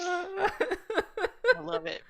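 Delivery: very slow, laughing delight. Real, not acted. Friend enjoying the joke, laughing. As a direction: let the laugh stretch the words.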